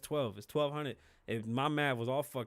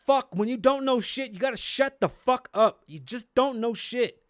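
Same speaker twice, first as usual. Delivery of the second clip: loud, higher angry outburst; angry rant; 9 semitones higher, 9 dB louder than usual. Real, not acted. A podcaster shouting at know-nothing youth, furious yell. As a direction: really let them have it, shouting.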